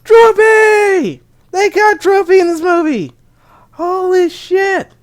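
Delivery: high, loud fan scream, the last words dragged slow; excited scream. Retold or imitated. Fan reacting to a cameo in the theater, screaming. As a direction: yell it out like you can't believe it. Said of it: "I screamed out and I said".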